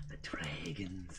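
slow whispered echo, mock ominous; hushed dread. Player reacting to talk of dragons, hushed mock dread. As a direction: whisper it like a spooky omen.

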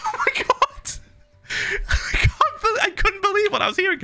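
high, laughing oh my God; laughing disbelief. Real, not acted. Streamer recalling the scene, laughing in disbelief. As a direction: laugh through it, still stunned.

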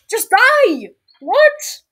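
loud, high outburst at an opponent; frustrated yell. Real, not acted. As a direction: shout it, leaping up in pitch.

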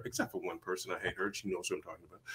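low, soft throwaway remark; muttered aside. Real, not acted. Actor slipping in a dig at one person, muttered.

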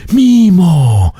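calling out a name in joyful surprise; excited cry. A narrator's reading. Friends call to Mimo together, an excited cry. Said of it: "wykrzyknęli chórem przyjaciele".

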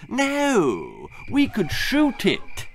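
gleeful brat. Acted. Percy suggests shooting.

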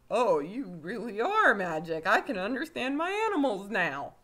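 loud, high, swooping astonishment; hammed-up awe at real magic; mock amazement. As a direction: gush in exaggerated disbelief. Dad amazed at magic, acted.

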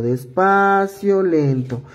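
drawn-out despacio, said slowly; slow demo. Shown as a demonstration. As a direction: stretch despacio out gently, very slow. (despacio, alargado y lento)

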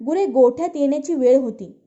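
usual speaking voice

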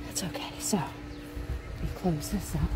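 soft breathy whisper, slowed down. Real, not acted. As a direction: whisper it under your breath. Whispers aside while closing camera.

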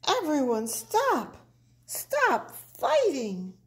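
high, urgent plea to a crowd; calling out. A narrator's reading. Pipsqueak tries to stop the fighting, calling out. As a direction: call over a brawl, stretching the last words.

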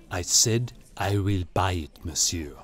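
slow, low insistence in character; calm, deadly serious. A narrator's reading. Gallery owner repeating his offer, slow and serious. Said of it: "He was calm now, deadly serious".

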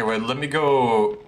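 ordinary talk, nothing marked

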